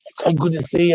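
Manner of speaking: high-pitched excited greeting, retold; mock-elated, pitched right up; imitating overjoyed cousin. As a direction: gush like a cousin just set free. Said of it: "He comes running out and he's like".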